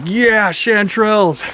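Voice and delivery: high gleeful cry of discovery; thrilled at finding the patch; excited find. Retold or imitated. Forager spotting chanterelles, high and thrilled. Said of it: "And I was like".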